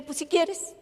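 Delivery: mock indifference; offhand shrug. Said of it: "Así de buena era yo promoviendo".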